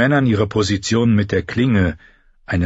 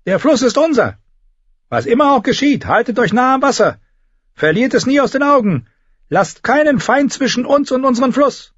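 rallying shout; 4 dB louder than usual. A narrator's reading. Commander rallying troops.

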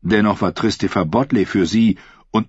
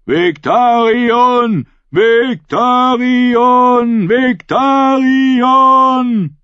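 high, very long-drawn, building chant of a name; crowd-rousing cry with stretched vowels; shouted battle cry; 7 dB louder, much slower than usual. A narrator's reading. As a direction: bellow it ever longer and louder. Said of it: "schwenkte das Banner der Greyjoys und schrie".

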